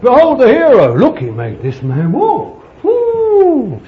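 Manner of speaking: loud, high hammed-up proclamation; mock acclaim. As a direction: hail a hero with showy mock awe, ending in a whoop. Mimics showy acclaim of a healer.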